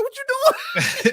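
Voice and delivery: very high, slow, laughing disbelief; incredulous high squeal. Retold or imitated. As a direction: squeak out the shock at the kid. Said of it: "I'm like".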